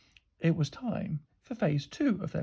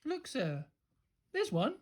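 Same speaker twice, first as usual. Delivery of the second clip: feigned helpfulness; 7 semitones higher, 9 dB quieter than usual. A narrator's reading. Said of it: "she said, pointing at nothing".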